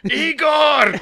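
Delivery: put-on high, loud cry in a Scottish-Russian accent. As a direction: yell the name in a mixed Scottish-Russian accent. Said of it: "a bunch of Russians with Scottish accents".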